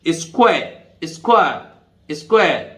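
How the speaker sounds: स्क्वायर drawn out slowly with no final r; silent r, slowly. Shown as a demonstration. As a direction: say स्क्वायर slowly, ending on the vowel. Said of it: "आर नहीं बोल रहा हूं तो स्क्वायर". (स्क्वायर को धीरे, अंत के र के बिना)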